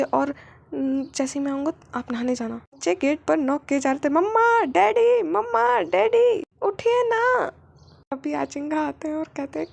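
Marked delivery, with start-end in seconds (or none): 4.1-7.5 put-on loud, high child calling at the door; children calling out. Children calling their parents through the door, loud and high. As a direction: shout for mummy and daddy. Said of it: "क्यों चिल्ला रहे हो".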